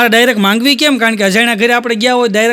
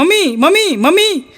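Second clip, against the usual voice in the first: high, sugary calls to mother-in-law; sweet calling mummy; 7 semitones higher than usual. Retold or imitated. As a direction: coo mummy like a doting daughter-in-law. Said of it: "mummy જ કરતી હોય".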